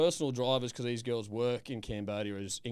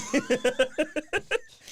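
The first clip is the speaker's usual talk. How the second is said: loud laugh; 6 dB louder than usual. Real, not acted. Laughs at Bruce Page joke.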